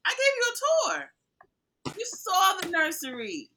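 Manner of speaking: slow high put-on voice, drawn out; mock reality star. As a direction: play the smug host showing off.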